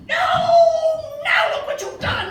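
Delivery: high, loud, exasperated snap; cranky baboon. A narrator's reading. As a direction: snap at the babies in frustration. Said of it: "oh so cranky".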